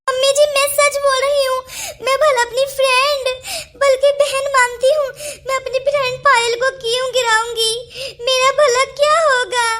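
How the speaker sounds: crying protest of innocence; wailing, hurt self-defence; tearful pleading. Acted. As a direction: plead through sobs, drawing out the endings. Accused woman pleading innocence.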